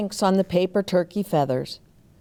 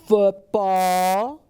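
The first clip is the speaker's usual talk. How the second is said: gasp. Acted. Excited realization in a list.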